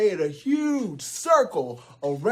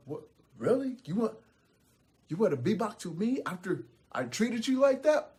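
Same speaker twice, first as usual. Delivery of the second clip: soft, higher-pitched astonishment; disbelieving troll; 5 semitones higher, 5 dB quieter, faster than usual. Acted. DJ Troll hears the goats still want him, softly astonished. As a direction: ask meekly, pitch lifted in disbelief.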